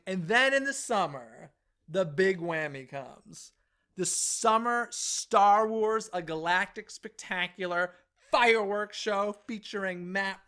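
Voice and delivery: slow, drawn-out movie-trailer voice; hyped announcer read. Acted. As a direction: sell it like an epic trailer.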